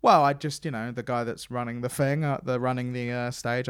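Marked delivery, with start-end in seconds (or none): none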